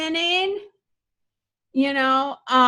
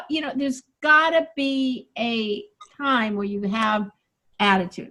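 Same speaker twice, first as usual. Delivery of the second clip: ordinary delivery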